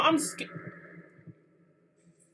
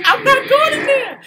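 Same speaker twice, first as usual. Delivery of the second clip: laughing high protest; 13 semitones higher, 9 dB louder than usual. Real, not acted. Refusing to enter scary area.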